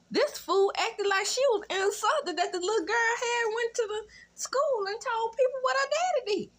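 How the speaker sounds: high, indignant, whiny mimicry; mock outrage. Commentator mocking the mother's outrage at her daughter, indignant. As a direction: sneer at her fake insult.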